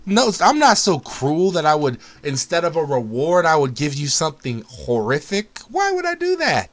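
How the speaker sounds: high, loud protest of innocence; mock innocence. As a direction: feign shock at the idea. Mock denial of cruelty.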